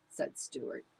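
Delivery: usual speaking voice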